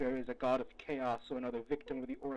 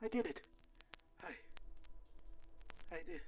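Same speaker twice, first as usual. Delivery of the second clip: stunned relief; 2 semitones higher, 11 dB quieter, faster than usual. Acted. Stunned relief after victory.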